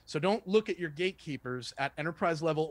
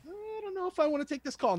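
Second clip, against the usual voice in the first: high-pitched, reluctant hedging; mock apprehensive; 14 semitones higher than usual. Coach imitating the wary prospect on a cold call, apprehensive. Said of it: "See how you're a little apprehensive?".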